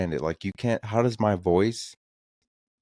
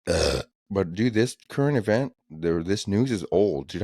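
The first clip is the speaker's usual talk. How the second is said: ordinary delivery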